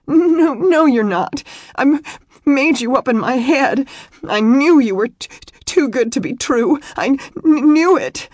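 high, loud, stammering sobs; hysterical insistence. A narrator's reading. Weeping woman insisting to him he is unreal, hysterical. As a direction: wail it with catches on words.

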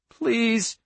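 high, pleading appeal. A narrator's reading. Girl begs agent not to force her hand, pleading. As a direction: beg in a small high voice.

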